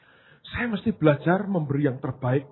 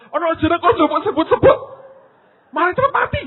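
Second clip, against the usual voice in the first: exasperated outburst; 9 dB louder than usual. Retold or imitated. Retold outburst at congregant.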